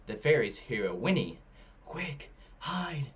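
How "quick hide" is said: hushed, higher fairy whisper; urgent hushed whisper to hide. A narrator's reading. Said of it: "whispers Storm".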